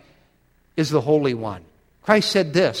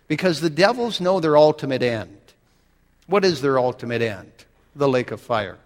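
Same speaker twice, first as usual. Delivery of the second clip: usual speaking voice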